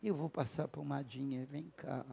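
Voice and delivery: put-on soft, slow pitying coo to a hurt child; retold tender parental soothing; soothing, consoling. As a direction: comfort a crying little one softly. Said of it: "ajudei ele a chorar".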